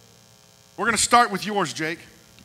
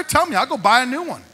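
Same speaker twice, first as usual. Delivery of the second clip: eager promise; 9 semitones higher, 4 dB louder than usual. Real, not acted. Pastor eagerly offering to buy everything.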